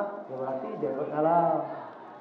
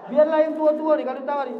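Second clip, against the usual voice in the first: loud laughing joke; 8 semitones higher, 7 dB louder than usual. Real, not acted. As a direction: laugh through the line. Joking about old people. (sambil tertawa keras)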